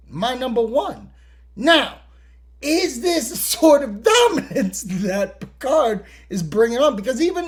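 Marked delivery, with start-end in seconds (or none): none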